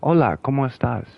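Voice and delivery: quick Spanish greeting; Spanish demo. Shown as a demonstration. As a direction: rattle off a Spanish hello. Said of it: "Like, I can do it".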